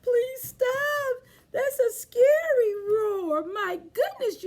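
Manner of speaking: high, loud pretend fright; mock scared. Teacher pretending the children's roar scares her, high and loud.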